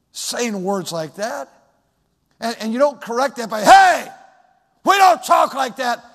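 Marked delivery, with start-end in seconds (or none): 3.6-6.0 put-on loud, high parental yell at a child; mock angry parent yell. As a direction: bark the scolding like an angry dad. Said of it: "raise your voice and put fear".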